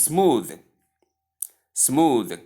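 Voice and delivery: "smooth" ending in a voiced th; correct smooth. Shown as a demonstration. Teacher modelling word number five, smooth pronounced with voiced th. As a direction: buzz the th at the end of "smooth". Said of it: "The only correct pronunciation is".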